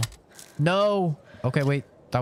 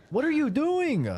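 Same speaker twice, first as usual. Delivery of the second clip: high incredulous question; exasperated disbelief. Real, not acted. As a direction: scold yourself in disbelief. Scolding his own clumsy move.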